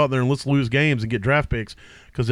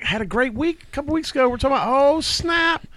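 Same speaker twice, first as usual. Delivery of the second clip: mock excitement; 9 semitones higher than usual. Mocking hype over a player.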